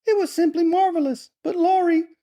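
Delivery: put-on very high falsetto girl's voice; overcome young girl. A narrator's reading. Laura confiding in her brother, girlish falsetto.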